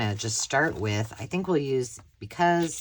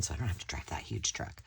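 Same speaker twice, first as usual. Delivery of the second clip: whispering; 5 semitones lower, 9 dB quieter, faster than usual. Real, not acted. Confiding aside about dreaded truck.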